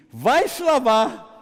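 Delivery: loud, high, drawn-out order; acted command. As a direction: call the command out across a crowd. Jesus's command to lepers, repeated. (ordem gritada, aguda e alongada)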